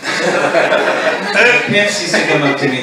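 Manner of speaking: ordinary delivery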